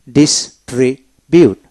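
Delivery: Distribute slowly broken into dis-tri-bute; syllable-by-syllable. Shown as a demonstration. Teacher showing how to read the verb, distribute slowly split. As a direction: sound out distribute piece by piece. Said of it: "আসুন কিভাবে পড়বেন".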